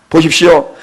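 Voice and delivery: ordinary delivery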